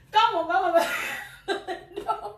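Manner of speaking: breaking into laughter mid-phrase; words dissolving into real laughter; laughing. Real, not acted. Laughing while joking to her viewers.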